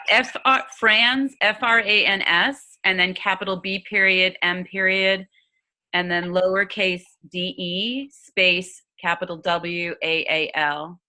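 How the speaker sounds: slow, letter by letter; dictating. Real, not acted. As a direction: spell it out slowly for someone typing. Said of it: "what was the author's last name?".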